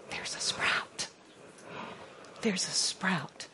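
soft, slow whisper; awed whisper; hushed reveal. Acted. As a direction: whisper it like a secret. Sermon climax, hushed reveal.

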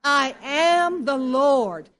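slow, loud congregational reading; reading aloud together. Real, not acted. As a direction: read it out slowly with the whole room. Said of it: "Read it with me".

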